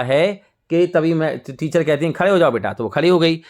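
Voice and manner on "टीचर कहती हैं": ordinary delivery, nothing marked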